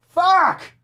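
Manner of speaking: sharp high yell of frustration; sudden shout. Real, not acted. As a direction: blurt it out loud and high. Sudden outburst.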